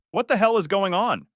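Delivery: irritated. Acted. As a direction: snap back at him defensively. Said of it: "defensive and irritated".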